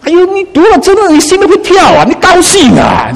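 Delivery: high-pitched, heartfelt excitement; delighted preacher bursting out; thrilled. Shown as a demonstration. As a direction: exclaim it high, heart leaping. Preacher acts out a thrilled reader.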